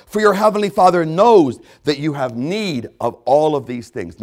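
loud, high-pitched pulpit preaching; full-force preaching. Acted. Preacher driving the worry passage home to his flock, full-force preaching. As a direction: hammer the words home to the congregation.

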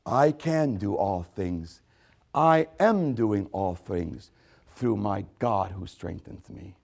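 normal talking voice

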